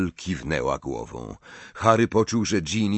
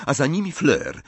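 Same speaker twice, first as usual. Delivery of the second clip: ordinary delivery, nothing marked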